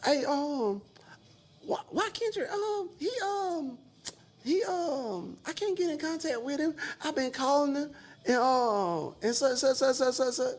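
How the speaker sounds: high, soft mock-worried woman's voice; imitating manager. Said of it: "She like".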